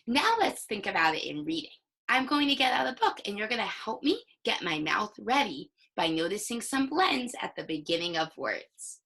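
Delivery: normal talking voice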